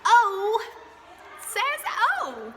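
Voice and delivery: loud and high teacher call; big excited teacher voice; emphatic. Shown as a demonstration. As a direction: belt it out to the class. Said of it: "emphatic teaching".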